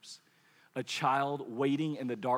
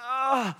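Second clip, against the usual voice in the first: a mock toddler groan, higher; imitated groan; 9 semitones higher than usual. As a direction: groan like a sulky child. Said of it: "You go from groaning".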